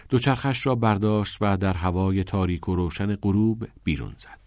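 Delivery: plain reading voice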